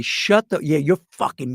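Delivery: ordinary talk, nothing marked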